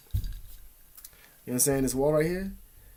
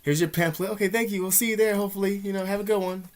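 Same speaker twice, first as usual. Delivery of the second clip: high, chipper polite farewell; breezy voice wrapping up a doorstep chat; a cheery brush-off, pitched up; polite goodbye; 7 semitones higher than usual. Retold or imitated. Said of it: "That's what a normal person would do".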